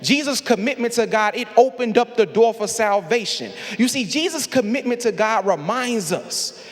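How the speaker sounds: rising preacher cadence, building fervor; Sunday sermon swell; preaching. Acted. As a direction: preach it, climbing toward the call.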